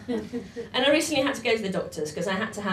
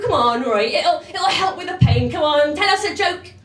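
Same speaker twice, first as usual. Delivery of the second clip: 1 pushy insistence; 6 semitones higher, 6 dB louder than usual. Retold or imitated. Enid pushes for a joke.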